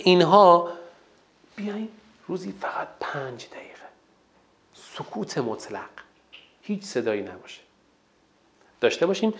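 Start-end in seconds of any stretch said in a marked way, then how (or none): 1.5-7.6 breathy whisper to show pure silence. Shown as a demonstration. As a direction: hush down as if the room must stay silent. Models silence, drops voice. (زمزمهٔ آرام و نجواگونه)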